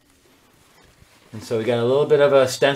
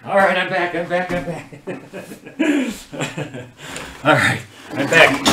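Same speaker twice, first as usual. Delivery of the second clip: excited return; 7 semitones higher, 4 dB louder than usual. Real, not acted. Returns excited from fetching eraser.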